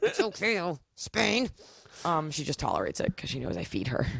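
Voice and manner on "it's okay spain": dog voice. Retold or imitated. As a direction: soothe the dog lightly. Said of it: "I talk in this voice".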